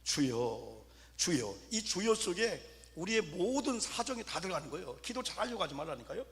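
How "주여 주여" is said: low praying plea. Retold or imitated. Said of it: "한 삼십 분 동안".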